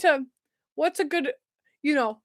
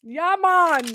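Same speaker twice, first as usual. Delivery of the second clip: higher, brief Jamaican catchphrase; Jamaican accent; 4 semitones higher, 3 dB louder than usual. Acted. Showing the Cool Runnings accent, Jamaican accent. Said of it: "It's a Jamaican accent".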